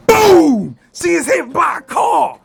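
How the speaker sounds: a sudden loud yell; shouted punchline. Acted. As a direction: slam the punchline out. Punchline crash.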